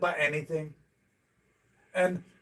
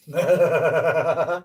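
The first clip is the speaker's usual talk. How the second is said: laughing; 3 dB louder than usual. Real, not acted. Laughs after the joke.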